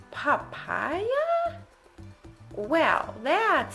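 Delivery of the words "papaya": long high swoop of bafflement; really puzzled. A narrator's reading. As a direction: draw it out into a high question. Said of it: "Pete and the gang were really puzzled".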